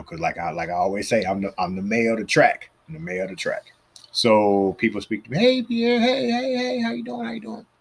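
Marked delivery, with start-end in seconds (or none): 2.9-3.7 a secret boast, whispered; hushed, unvoiced repeat; whispered aside. Real, not acted. Repeats his title as aside. 5.4-7.7 mock cheery greeting. People greeting him at track.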